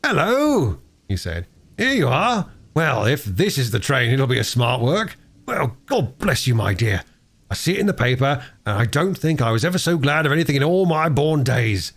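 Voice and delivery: a hint of a cheery railway porter, brighter pitch; an old porter overjoyed at good news; lightly voiced porter. Perks greets Bobby joyfully.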